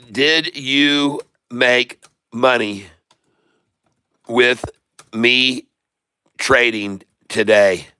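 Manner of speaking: asking again slowly so everyone gets it; slow, deliberate question. Real, not acted. Streamer repeats question slowly for viewers.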